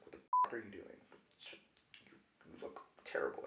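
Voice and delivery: very soft, half-whispered retort; imitated under-the-breath dismay; hushed disbelief. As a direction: mutter it like an appalled onlooker. Onlookers' dismayed remark, retold.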